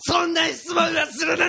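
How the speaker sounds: outraged shout. Pretend. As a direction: bellow the refusal slowly. Repeats the refusal louder.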